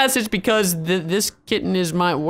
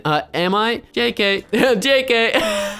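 usual speaking voice

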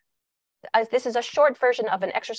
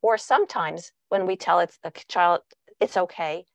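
ordinary delivery

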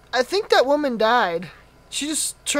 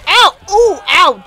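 loud, high "ow, ooh, ow"; pained yelps; 8 semitones higher, 12 dB louder, slower than usual. Real, not acted. Player hit near the nurse, loud repeated yelps. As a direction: yelp at each blow in turn.

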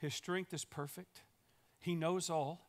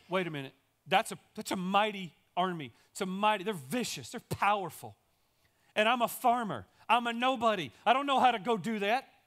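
higher and louder, worked-up objection; alarmed protest; 4 semitones higher, 8 dB louder than usual. Acted. Gideon protesting to God that the army is too mighty, alarmed. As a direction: argue back, overwhelmed and loud.

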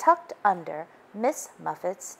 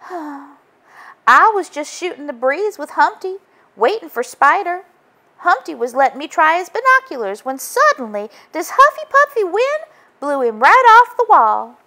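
put-on higher, louder girl voice, building; Miss Muffet voice; 10 semitones higher, 8 dB louder than usual. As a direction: Muffet telling Joe what happened. Muffy explains after sighing.

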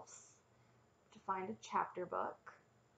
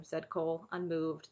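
ordinary delivery, nothing marked

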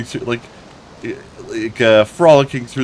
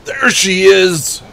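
excited; 8 semitones higher, 5 dB louder, much slower than usual. Real, not acted. Spotting the girl in game.

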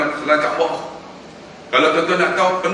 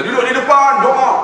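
calling out command; 10 semitones higher, 6 dB louder than usual. Retold or imitated. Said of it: "Saya kata".

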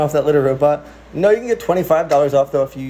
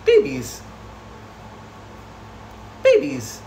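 much higher sing-song call; calling the cats; 4 dB quieter, slower than usual. Real, not acted. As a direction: call out to the babies, sweet and high.

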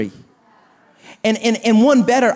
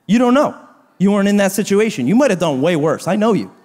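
ordinary talk

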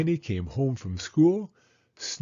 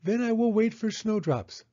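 put-on high child's voice, anxious questions; Penny worrying to Mama, high and anxious; worried little girl; 11 semitones higher than usual. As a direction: fret like a small girl who fears the worst. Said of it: "she said".

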